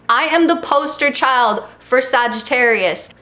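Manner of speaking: ordinary talk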